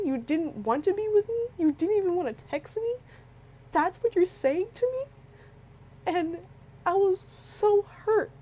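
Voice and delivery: breaking up while retelling the hurt; real crying. Real, not acted. Girl telling viewers about her breakup, crying for real. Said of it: "I'm sorry I'm crying".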